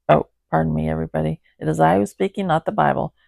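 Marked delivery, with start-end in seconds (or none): none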